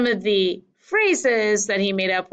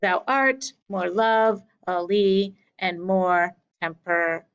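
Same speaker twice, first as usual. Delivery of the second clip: slow metrical reading of "Thou art more lovely", broken off at "temper-"; iambic rhythm, misread; slower than usual. Shown as a demonstration. Teacher attempting the meter for students, iambic rhythm gone wrong. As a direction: beat out "Thou art more lovely" slowly in units, then falter. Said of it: "sorry, I didn't read that right".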